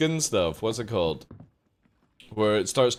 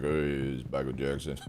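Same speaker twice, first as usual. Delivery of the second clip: low, soft mock bass voice; imagine him speaking deep and low; 9 semitones lower, 7 dB quieter than usual. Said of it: "He was like".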